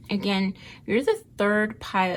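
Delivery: ordinary talk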